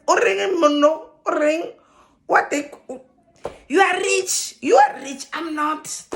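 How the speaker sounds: high, loud, indignant Setswana-English outburst; livid rant. Retold or imitated. Mother furious at the president, ranting high and loud. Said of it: "I was livid".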